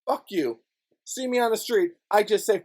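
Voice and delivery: ordinary talk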